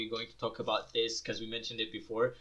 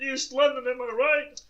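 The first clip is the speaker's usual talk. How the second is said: high, loud hammed-up London shout; mock yelling guy; 11 semitones higher, 7 dB louder than usual. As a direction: bellow it like a rowdy lad at a party. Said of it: "he's just fucking yelling".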